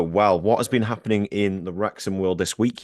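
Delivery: ordinary talk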